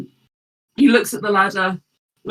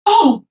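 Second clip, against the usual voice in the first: high loud yelp of shock; sudden high loud gasp; Kev startled; 10 semitones higher, 9 dB louder than usual. Acted. Kev sees the golem on the ground, high startled yelp. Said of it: "and he says".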